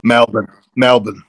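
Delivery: "Melbourne" as MEL-bun, second syllable swallowed; local pronunciation. Shown as a demonstration. Guest shows the host how locals say it, "Melbourne" as Mel-bun. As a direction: reduce the end of "Melbourne" to a short "bən".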